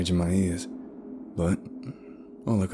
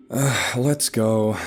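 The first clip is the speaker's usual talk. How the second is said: slow, louder growl; drawn-out grumpy growl; 5 semitones higher, 5 dB louder, much slower than usual. A narrator's reading. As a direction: growl it in grudging irritation. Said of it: "she growled".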